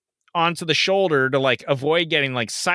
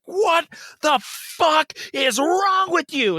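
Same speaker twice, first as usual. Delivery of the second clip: much higher, slow furious yell; going off on her; 13 semitones higher, slower than usual. Retold or imitated. Driver confronting a teenage girl, furious. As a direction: lay into her with every word. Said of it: "going off on her".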